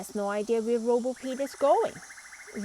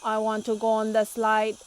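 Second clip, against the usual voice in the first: interrupting robot; 6 dB louder, faster than usual. A narrator's reading. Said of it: "interrupts Robo Pete".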